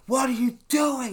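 high, loud angry yell; imitating a buddy's pissed-off screech; furious shout. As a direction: bark it at the cousin in a rage. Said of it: "He's super pissed".

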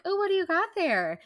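high-pitched, playful character voice; acted Maggie quote. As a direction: lift it high as a nosy girl asking. Voicing Maggie in story.

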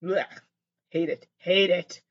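ordinary talk, nothing marked

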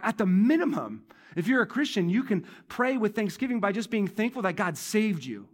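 ordinary delivery

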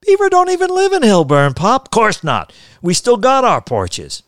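loud, high-pitched contradiction; heated family arguing at full voice; indignant protest. Acted. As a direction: burst out in disbelief at Pop. Son loudly contradicting his father.

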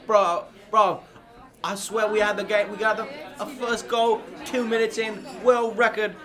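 a heartbroken London fan after penalties; hammed-up British lad venting about the loss; mock gutted English fan. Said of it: "Rory, what is the mood like".

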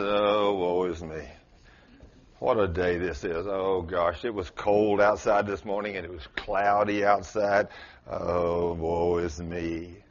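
low, drawn-out self-pitying moan; mopey whining. Pretend. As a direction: moan like a sorry complainer. Mocking a complainer's moaning.

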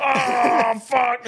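high yelp of pain; pained cry. Retold or imitated. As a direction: cry out like you got impaled. Impaled man crying out.

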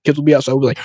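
ordinary talk

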